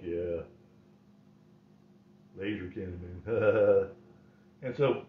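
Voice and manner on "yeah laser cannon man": lower, quieter, lightly voiced old man; smug and lazy, half to himself; low lazy brag. Grandpa bragging about his fart.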